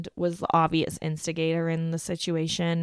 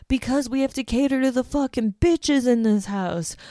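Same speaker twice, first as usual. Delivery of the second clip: mock furious, pitched higher; imitating an angry rant; 6 semitones higher than usual. Kirk ranting at the housemates, mock angry. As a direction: rant like he's lost it. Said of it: "And Kirk loses it".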